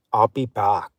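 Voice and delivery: put-on low, Austrian-accented catchphrase; growing into the action star's Austrian accent, pitched low; Terminator. Host correcting the Terminator quote, Austrian-accented impression. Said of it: "He'll be back from Terminator".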